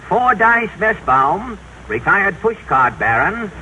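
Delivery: usual speaking voice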